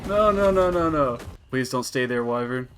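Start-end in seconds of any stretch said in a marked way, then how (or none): 0.1-1.2 loud pleading no no no; panicked no. Real, not acted. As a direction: beg it not to happen, loudly. Creature escaping the trap.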